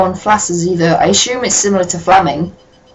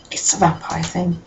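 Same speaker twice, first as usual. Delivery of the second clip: softer, hushed vampire thing; soft mock hush; 8 dB quieter than usual. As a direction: murmur it conspiratorially.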